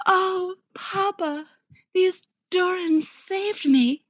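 very high, slow, put-on daughter voice; syrupy fake gratitude to Papa; hammed-up damsel. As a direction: play the rescued damsel, drawn out and high. Tauriel spins it to her father.

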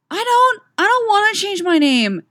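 very high, loud protest; screaming girl. A narrator's reading. Girl protests to coach about changing names, screaming. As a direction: cry out over the other girls. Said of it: "screaming, bouncing girls".

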